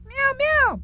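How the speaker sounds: high little kitten meow; cat meows. Acted. Kitten meows at the studio owner, high-pitched. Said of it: "You're talking like a damn cat".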